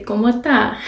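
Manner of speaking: "¿Cómo está?" slurred to "como 'tá"; slangy Dominican. Shown as a demonstration. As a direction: drop all the S's in "está", leaving just "tá". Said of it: "really sound slangy".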